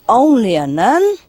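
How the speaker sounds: affronted echo with a swooping rise on nun; indignant. Acted. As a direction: throw it back as an offended question. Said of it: "the nun was very indignant".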